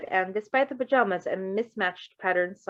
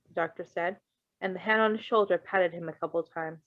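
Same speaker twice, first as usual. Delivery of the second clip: ordinary delivery, nothing marked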